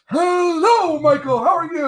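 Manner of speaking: big announcer bravado greeting; Howard Finkel voice. Retold or imitated. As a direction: boom it across the boardwalk like the Fink. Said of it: "literally the voice, like, with all that bravado".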